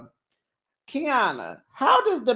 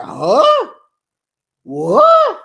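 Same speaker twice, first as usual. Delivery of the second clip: higher and louder, mock dumbfounded; mock shocked huh; 12 semitones higher, 10 dB louder than usual. Host imitating the boyfriend's stunned reaction, higher and louder. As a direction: play him caught off guard. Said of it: "he looked at her like".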